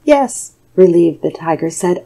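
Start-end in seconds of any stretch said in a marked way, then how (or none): 0.0-0.5 monkey voice. A narrator's reading. Monkey's careless reply.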